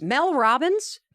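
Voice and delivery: high, loud, startled call of a name; sudden recognition across the room; surprised recognition. Retold or imitated. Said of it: "looks up and says".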